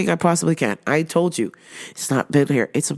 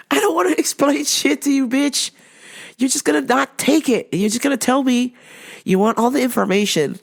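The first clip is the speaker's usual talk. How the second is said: high-pitched mock exasperation; fed up, voice pitched up; exasperated rant; 9 semitones higher than usual. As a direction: rant in comic disbelief at a difficult customer. Acted inner rant at the customer.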